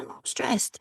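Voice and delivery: soft whispered insistence; hushed stubborn denial. Retold or imitated. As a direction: deny it under your breath. Retold denial to doctor.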